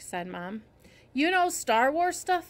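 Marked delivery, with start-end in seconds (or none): 1.1-2.5 high, slow, surprised question; raised-pitch, wondering question; curious question. A narrator's reading. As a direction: ask with interest, lifting KNOW. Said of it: "I asked the ear doctor".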